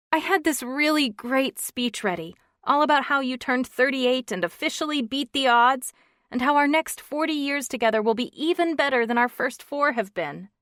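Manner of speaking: lightly voiced emotional wife; upset woman's voice. Wife describes her speech.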